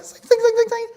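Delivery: very high, loud mock sword noises; zing it like a magic sword powering up; sword zing sound. Imitating a game sword to the audience, high ringing zings. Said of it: "you're like".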